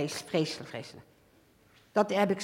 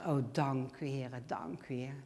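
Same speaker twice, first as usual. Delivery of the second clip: soft, lower thankful prayer; quiet grateful prayer; 6 semitones lower, 9 dB quieter than usual. Retold or imitated. Believer thanking God at night, soft and grateful. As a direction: murmur thanks to the Lord.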